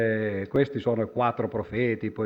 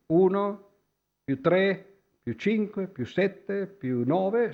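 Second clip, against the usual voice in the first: slow, higher, counting out each sum; 7 semitones higher, much slower than usual. Shown as a demonstration. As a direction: spell out the sum step by step. Counting the odd numbers aloud. (conteggio lento e scandito)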